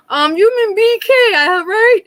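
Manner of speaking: teasing son. Retold or imitated. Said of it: "and he's like".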